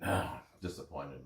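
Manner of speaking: ordinary talk, nothing marked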